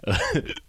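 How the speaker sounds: squeaky hiccuping giggle; a giggle caught on a hiccup. Real, not acted. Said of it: "did y'all just hear that?".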